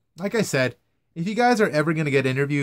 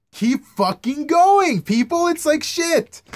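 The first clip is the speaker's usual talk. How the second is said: loud, high, slow frustrated plea; exasperated outburst; 14 semitones higher, 6 dB louder, slower than usual. Real, not acted. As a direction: beg them to keep talking, exasperated. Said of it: "pleading interviewee to continue".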